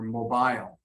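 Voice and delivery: "mobile" stressed on the second syllable, mo-BILE; verb-style end stress. Shown as a demonstration. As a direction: put the weight on the end of "mobile". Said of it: "I would typically stress it where you stress it".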